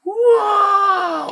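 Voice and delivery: long, piercing high shriek; sudden loud, high-pitched wail; child's possessed scream. Retold or imitated. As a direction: imitate a scalded child shrieking wildly. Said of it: "anak jeritnya itu kayak orang kesetanan".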